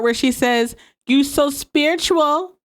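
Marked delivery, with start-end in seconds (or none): 1.1-2.6 a light attempt at a Caribbean accent, higher and slow; "You so spiritual" drawn out in a hinted Caribbean lilt. Quoting Vicky's line to the audience in a light Caribbean accent. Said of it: "I can't even say it the way she says it".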